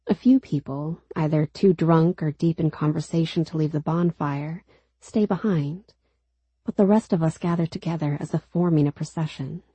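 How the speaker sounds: ordinary delivery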